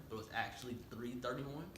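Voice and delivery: normal talking voice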